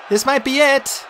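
high-pitched, understated last words; voiced dialogue. A narrator's reading. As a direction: say it high, bracing for the end. Doomed heroes' words before charging.

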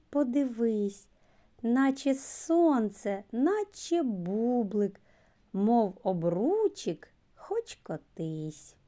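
slow, sing-song rhyme recital; children's rhyme. Acted. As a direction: recite the little poem to the class.

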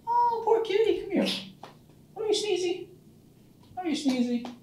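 very high sing-song pet voice. Real, not acted. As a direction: coo at the kitty in a squeaky high voice. Coaxing his cat to come.

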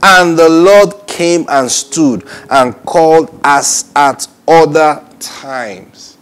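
slow, loud, high declamation; proclaimed scripture. Acted. Preacher reads verse ten to listeners, slow proclaimed scripture. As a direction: draw out each phrase of the verse.

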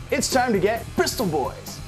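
ordinary delivery

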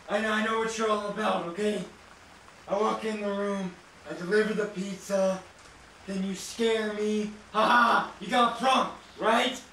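ordinary delivery

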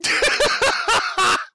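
shrieking burst of laughter; loud high laugh. Real, not acted. Commentator cracking up at the clip, shrieking laugh. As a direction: cackle out loud.

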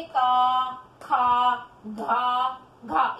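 "का, खा, घा, घा" with each long aa held; pronunciation demo. Shown as a demonstration. Teacher showing children the sound, "का, खा, घा, घा" drawn out. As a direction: stretch the vowel in "का, खा, घा, घा". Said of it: "इसका उच्चारण ऐसे होएगा".

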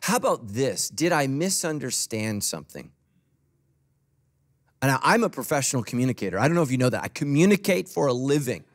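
ordinary delivery

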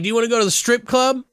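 very high, fast, hammed-up invitation; sing-song it like a wheedling pal; goofy high invite. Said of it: "he's like".

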